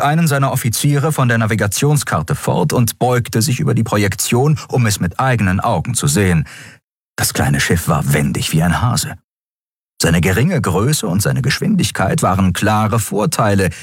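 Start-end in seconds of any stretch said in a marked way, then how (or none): none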